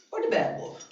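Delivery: high hissed whisper for the bad wolf; spooky bad wolf. Speaker showing the congregation the bad wolf, whispered. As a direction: make the bad wolf sound creepy.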